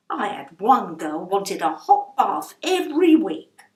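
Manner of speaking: indignant gossip about a fussy lodger; mock outrage; outraged landlady.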